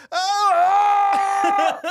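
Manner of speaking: long, very loud acted yell; manned-up yell. Rider manning up through cramps, long loud yell. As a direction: hold a big hammed-up yell of pain. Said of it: "I just man the fuck up".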